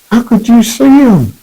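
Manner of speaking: incredulous. Retold or imitated. Alvin doubts the blind man.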